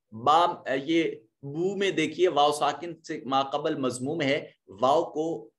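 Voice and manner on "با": usual speaking voice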